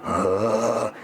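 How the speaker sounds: very low guttural grunt; low animal grunt. Retold or imitated. Down on the ground, growling at the gang in a low grunt. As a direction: grunt from deep down like a beast. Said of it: "And I'm going".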